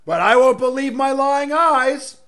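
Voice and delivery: mock it loudly, as if the obvious were hidden; sarcastic. Real, not acted. Sarcastic jab at election claims.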